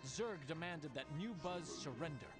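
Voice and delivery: plain reading voice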